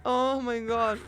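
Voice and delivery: high shaken after a scare; scared horror game streamer. Real, not acted. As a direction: still rattled from the jump scare. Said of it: "Just got Freddied".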